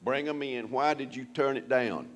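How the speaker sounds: normal talking voice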